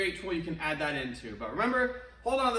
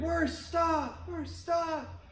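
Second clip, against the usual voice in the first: fading echo; 10 semitones higher, much slower than usual. Retold or imitated. The valley throws the kid's words back, fading. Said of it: "And they heard".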